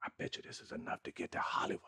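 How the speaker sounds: breathy secret whisper to himself. Retold or imitated. Talking himself into leaving for Hollywood, whispered. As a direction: whisper it like a thought you dare not say. Thinking to himself about the check.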